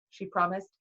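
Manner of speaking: ordinary delivery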